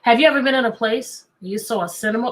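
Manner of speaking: usual speaking voice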